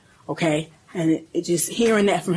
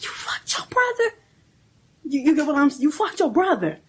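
higher, stunned accusing cry; retold shocked outburst; 8 semitones higher than usual. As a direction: relive the moment she realised, in disbelief. Mother confronting son in retelling.

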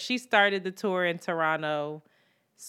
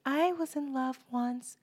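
a hint of a soft, sweet higher voice; sweet little voice; 7 semitones higher, 4 dB quieter than usual. Imitating Shanti's sweet little voice, lightly. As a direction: lightly mimic her sweet singing voice. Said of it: "with her little sweet voice".

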